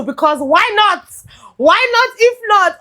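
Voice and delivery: high, insistent "why not"; emphatic giving-in. Acted. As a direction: burst out as if finally surrendering to love. Acts out giving in to love.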